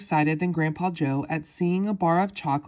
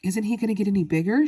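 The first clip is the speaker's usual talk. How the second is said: ordinary delivery, nothing marked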